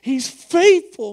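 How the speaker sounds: slow, loud proclamation; fervent declaration. Real, not acted. Preacher to the congregation, loud and slow. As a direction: proclaim it with full force.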